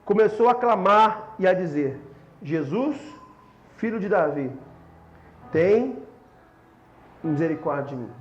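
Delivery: low, measured reading with long pauses; solemn scripture reading. Acted. Reads the verse aloud. (leitura bíblica solene, em voz grave e pausada)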